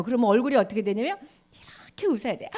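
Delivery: ordinary talk, nothing marked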